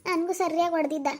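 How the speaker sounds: soft, pained complaining about a hurt leg. Acted. Woman with a hurt leg pleading with Pravi, soft and pained. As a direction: talk quietly, slowing near the end as if it hurts to move. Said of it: "ನನ್ಗೂ ಕಾಲ್ ನೋವಾಯ್ತಪ್ಪ".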